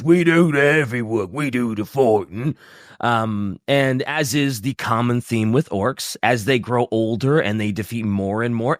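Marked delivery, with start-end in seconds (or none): 0.0-2.6 put-on orc bruiser in a hooligan accent. Host speaking as the orc bruisers, hooligan orc voice. Voices orcs describing their own job.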